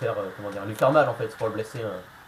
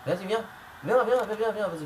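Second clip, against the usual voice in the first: higher, rapid "viens" goading; excited taunt; 8 semitones higher than usual. Real, not acted. Player goading his wrestler's rival to come at him, excited. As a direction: egg the opponent on.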